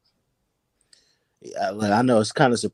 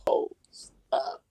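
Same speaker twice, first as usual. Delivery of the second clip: breathy laughing whisper; half-laugh, then whisper it away; emotional whisper; 8 dB quieter than usual. Real, not acted. Host choked up about training camp, laughing whisper. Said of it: "I get emotional".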